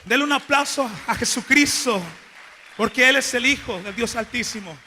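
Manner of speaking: loud preacher's call for applause; rousing call. Real, not acted. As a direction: shout it out to the church. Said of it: "calling the congregation to applaud". (grito fuerte a la congregación)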